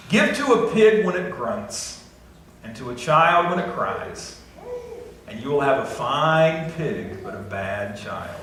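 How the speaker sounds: "Give to a pig when it grunts" read slow and weighty; slow proverb reading. Shown as a demonstration. Pastor reading a proverb to the congregation, slow and weighty. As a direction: lay the proverb out slowly, word by word. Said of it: "this is a good one".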